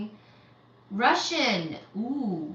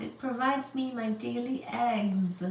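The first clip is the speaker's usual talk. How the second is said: ordinary talk, nothing marked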